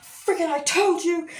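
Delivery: high, excited told-you-so; retold triumph. To Chris after being proved right, triumphant.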